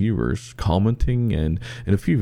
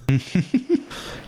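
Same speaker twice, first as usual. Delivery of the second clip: laugh; 13 semitones higher than usual. Real, not acted. Laughing at the punchline.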